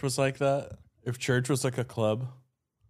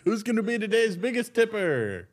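high hyped-up announcer call; 11 semitones higher than usual. Pretend. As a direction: hype the crowd like an MC. Mock megaphone announcement.

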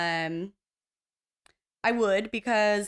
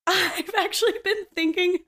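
laughing; 11 semitones higher, 4 dB louder than usual. Real, not acted. Laughing at own forgetfulness.

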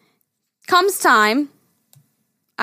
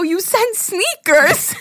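slow disbelief; 8 semitones higher, much slower than usual. Retold or imitated. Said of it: "I go".

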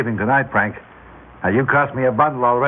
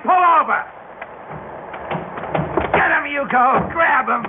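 high, urgent calls; yelling commands during the chase; shouted orders; 11 semitones higher than usual. Acted. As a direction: bark orders at the henchman. Boss urging on a car chase.

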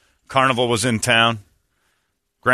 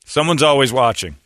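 usual speaking voice

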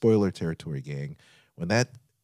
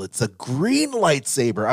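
normal talking voice

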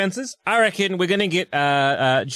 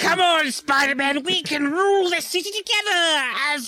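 high goblin villain voice; 13 semitones higher than usual. Retold or imitated.

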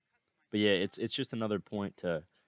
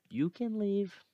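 high mock dismissal; 12 semitones higher, much slower than usual. Mocking his doubt in a much higher voice. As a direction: jump much higher and tease.

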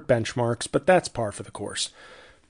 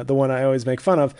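ordinary talk, nothing marked

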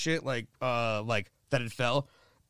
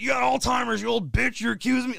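raised, high indignant yell; mock angry dad; 8 semitones higher, 3 dB louder than usual. Con man dad yelling back at an old lady. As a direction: shout her down as a con man.

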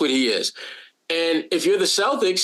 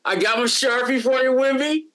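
higher-pitched mock fan calling out; playful autograph seeker; 6 semitones higher than usual. Mock fan begging Wemby for an autograph, playfully higher. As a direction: pester a player playfully in a higher voice.

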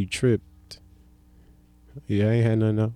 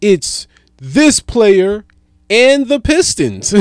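announcer quote; 8 semitones higher, 5 dB louder, much slower than usual. Retold or imitated. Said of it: "they go".

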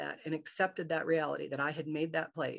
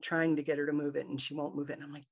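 ordinary talk, nothing marked

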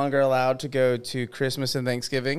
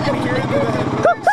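bigfoot grunting; 6 dB louder than usual. Pretend. Bigfoot call demo.

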